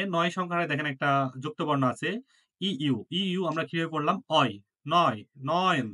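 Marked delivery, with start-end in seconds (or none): 4.3-5.9 অয়, নয়, নয়ন built up sound by sound, নয়ন with the অয় glide; pronunciation demo. Shown as a demonstration. Teacher showing students the অয় sound, pronunciation demo. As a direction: show how অয় grows into নয়ন. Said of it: "উচ্চারণটা ওরকম অয়ের মতোই আসতেছে".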